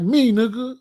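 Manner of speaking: put-on Jamaican-accented "me" with a rising tune. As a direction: answer the door in a lilting Jamaican accent. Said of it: "they don't hear that accent".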